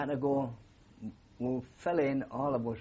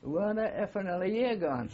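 higher-pitched mock bellow from a sergeant; retold angry shout; 7 semitones higher than usual. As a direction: toss it like an outraged warrant officer across the square. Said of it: "he shouts out".

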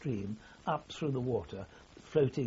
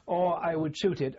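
ordinary talk, nothing marked

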